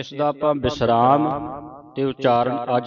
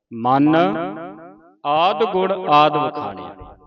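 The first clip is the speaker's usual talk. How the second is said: firm ਮਨ, a clear rest, then the phrase unbroken; pause after ਮਨ; 4 dB louder than usual. Shown as a demonstration. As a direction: stop on ਮਨ before reciting on. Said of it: "ਹੁਣ ਮਨ ਤੇ ਵਿਸਰਾਮ".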